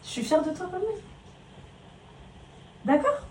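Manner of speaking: calm him so he listens; gentle mother talk. Real, not acted. Mother talks softly to her son about school.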